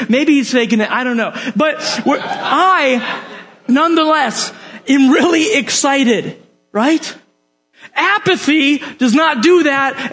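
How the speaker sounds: ordinary talk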